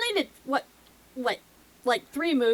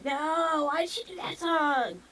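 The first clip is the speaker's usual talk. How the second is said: mock viewer complaint; 7 semitones higher than usual. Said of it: "are you just gonna flip through and say".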